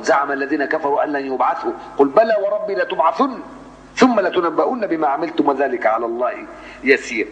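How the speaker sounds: Quran recitation. Shown as a demonstration. Teacher reciting the Taghabun verse in measured recitation. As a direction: lift the voice on "بلى" as you chant.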